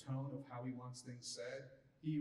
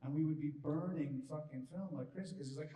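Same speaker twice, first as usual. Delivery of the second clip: normal talking voice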